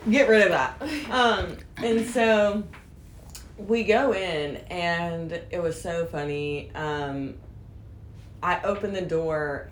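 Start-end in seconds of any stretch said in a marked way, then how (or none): none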